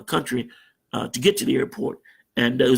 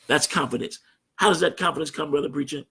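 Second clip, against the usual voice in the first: usual speaking voice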